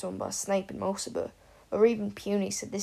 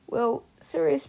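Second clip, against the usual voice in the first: plain reading voice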